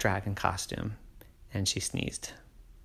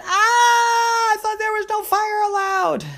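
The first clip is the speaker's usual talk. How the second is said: high, loud mock shriek; hammed-up child's cry; startled shriek; 6 dB louder than usual. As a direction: cry out in pretend alarm. Poppy cries out at fireball.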